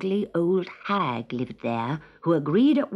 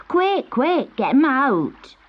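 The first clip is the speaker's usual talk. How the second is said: put-on high, louder child urging help; urgent cry; 6 semitones higher, 4 dB louder, slower than usual. A narrator's reading. Girl urging the others to rescue the kitten, urgent high cry. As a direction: call out urgently, high-pitched. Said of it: "cried one of the girls".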